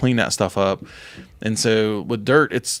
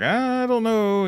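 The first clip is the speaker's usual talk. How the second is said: high, slow, drawn-out doubtful tone; hesitant doubtful voice; 12 semitones higher, much slower than usual. Retold or imitated. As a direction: stretch it out, unsure and reluctant. Imitating Dustin's hesitant reply.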